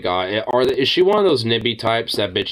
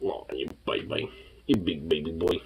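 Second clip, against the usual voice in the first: soft, low murmur to the pet; tender cooing; 5 semitones lower, 9 dB quieter than usual. Real, not acted. Owner softly crooning to her baby pet. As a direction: croon it gently, close.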